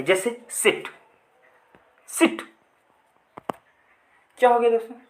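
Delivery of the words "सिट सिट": सिट said short with a quick, light vowel. Shown as a demonstration. Teacher giving students an example of short sound, short vowel in सिट. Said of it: "जिसे बोलने में हमें कम sound देना पड़े".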